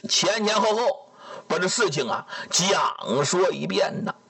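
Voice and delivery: ordinary delivery, nothing marked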